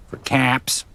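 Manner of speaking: imitating the robot's way of saying it. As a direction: say it like the game robot does. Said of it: "that's how the robot says it".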